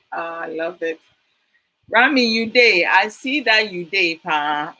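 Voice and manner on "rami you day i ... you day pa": loud, bright call-out to a viewer; excited greeting. Real, not acted. Host spots a regular viewer in the live chat, loud excited greeting. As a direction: hail a friend who just joined the stream.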